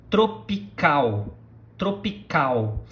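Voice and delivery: "tropical" ending in an oo glide, slowly; final L as U. Shown as a demonstration. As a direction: let the last L of "tropical" turn into a U sound. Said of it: "we do not do the L song".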